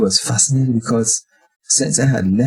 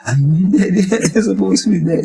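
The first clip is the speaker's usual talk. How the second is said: amazed; 9 semitones higher than usual. Retold or imitated. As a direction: tease him that he should be dead. Amazed, laughing at vegetarian.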